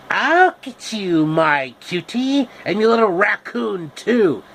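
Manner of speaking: hammy witch threat. Acted. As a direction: menace it like the Wicked Witch. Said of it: "Wicked Witch threat".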